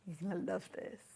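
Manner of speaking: soft, confiding build-up; hushed teaser. Real, not acted. Presenter about to reveal fabric to viewers, hushed. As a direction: say it quietly, as a teaser.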